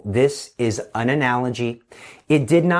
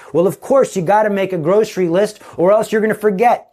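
high, know-it-all retort, a bit louder; mocking listeners; 6 semitones higher, 3 dB louder, faster than usual. Listeners in the pews answering back, higher and smug. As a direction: play the smug audience, higher.

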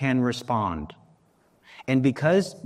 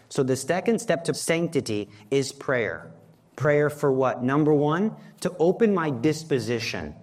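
ordinary talk

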